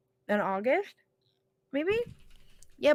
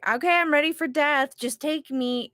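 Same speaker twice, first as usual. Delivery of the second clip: raised, louder retold plea; mock exasperated grandma; 6 semitones higher, 5 dB louder than usual. Grandchild imitating grandma who is ready to go, mock exasperation. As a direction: play up her impatience for comic effect. Voicing grandma fed up with living.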